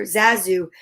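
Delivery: ordinary delivery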